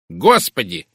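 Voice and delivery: loud burst of indignation; indignant exclamation. A narrator's reading. Woman asked yet again about the husband, indignant exclamation. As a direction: cry out, outraged at being asked. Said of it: "возмущённо воскликнула мисс Говард".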